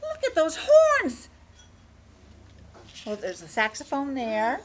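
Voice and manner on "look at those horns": high, loud, drawn-out exclamation; excited. Acted. Kid at the window spotting horns, excited. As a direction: call out slowly in high wonder.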